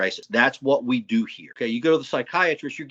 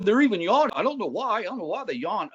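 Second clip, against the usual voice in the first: higher, sing-song parody of the psychiatrist; mimicking a clueless doctor to embarrass him; mocking imitation; 9 semitones higher than usual. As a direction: pitch up and ridicule his words. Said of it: "you embarrass yourself by saying".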